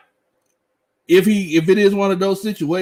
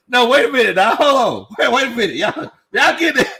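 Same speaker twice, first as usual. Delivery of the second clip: excited protest; 9 semitones higher than usual. Real, not acted. Streamer protesting a chat joke about getting naked, high and excited.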